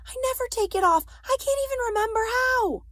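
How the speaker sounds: high, loud, rushed panic; frantic. A narrator's reading. Ellen telling her friend the necklace is stuck, frantically. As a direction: race into helpless alarm. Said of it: "Ellen said frantically".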